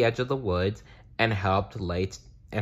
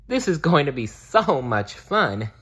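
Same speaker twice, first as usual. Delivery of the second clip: giggling; 6 semitones higher than usual. Acted. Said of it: "giggled Bear".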